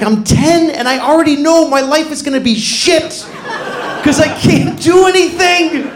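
put-on despairing kid. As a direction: wail like a hopeless ten-year-old. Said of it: "I was like".

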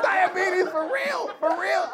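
offended yell. Retold or imitated. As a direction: shout back, deeply insulted. Offended comic repeating outrage.

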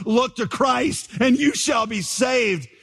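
high, slow, heartfelt proclamation; ringing, near-sung pulpit call; preacher's exhortation. Acted. Acted exhortation in retold conversion.

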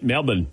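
Melbourne as "MEL-bin", R dropped; Melbourne with a short, swallowed second syllable; local pronunciation. Retold or imitated. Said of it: "driving people crazy with calling it".

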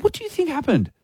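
high-pitched acted bewilderment; retold high, puzzled question; baffled protest. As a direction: protest your innocence, voice pitched right up. Acted protest to accusing barman.

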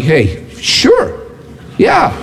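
mock-agreeable, leaping high on yeah; drawn-out doubtful sure; sarcastic agreement. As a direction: feign going along with a stranger. Imagined sarcastic reply to the stranger.

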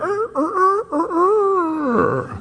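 high, hammed-up falsetto sobs; mock sobbing. Preacher imitates Peter weeping bitterly, high falsetto sobs.

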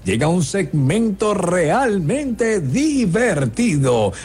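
presentador de circo pomposo, más agudo; ringmaster. Acted. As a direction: anuncia el número como en la pista. Presents the joke segment like a show. (voz de maestro de ceremonias)

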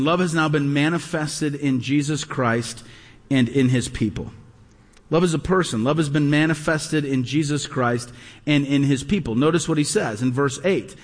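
usual speaking voice

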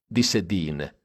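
usual speaking voice

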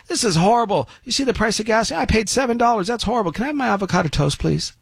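high, whining mock complaint; mock whiny complainer. Host mocking well-off Californians at brunch, high whiny complaint. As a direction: whine like a pampered diner.

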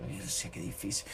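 barely voiced, whispered aside; whispered retold dismay; hushed dismay. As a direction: sigh it under your breath, almost no voice. Retells his dismay to himself.

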